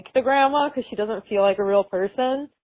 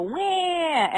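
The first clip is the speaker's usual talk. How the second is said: a high, short fake crying wail; mock wail; 7 semitones higher, 4 dB quieter than usual. Shown as a demonstration. Host telling her co-host how to mark every cry, a high fake wail. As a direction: show the cry you expect from her. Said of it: "Just go".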